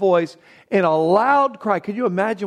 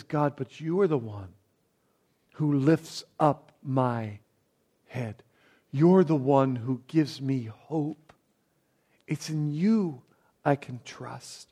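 quiet prayer; 3 semitones lower, 5 dB quieter than usual. Retold or imitated. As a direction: pray it gently, unhurried. David's prayer quoted softly.

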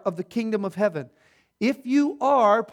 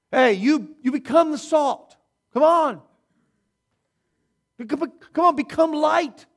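higher and louder, a hammed-up nagging preacher; mock-shove them, "come on"; mock pushy exhorter; 6 semitones higher, 6 dB louder than usual. Preacher mocks a pushy call to become salt, hammed-up loud.

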